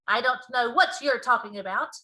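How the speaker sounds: loud, irritated king; testy. A narrator's reading. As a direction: snap back impatiently. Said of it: "growing testy".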